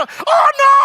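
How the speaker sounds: sudden high yelp of fear; panicked cry. Retold or imitated. Passenger panics in plane story. (gulat na sigaw)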